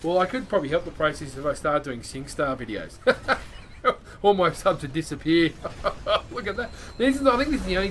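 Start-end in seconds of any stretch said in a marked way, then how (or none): 3.0-4.1 a quick airy chuckle at his own joke; breathy laugh. Real, not acted. Laughing at his own joke about subs vanishing, breathy chuckle.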